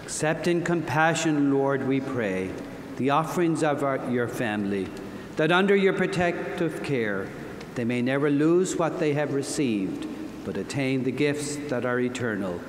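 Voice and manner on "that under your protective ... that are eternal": usual speaking voice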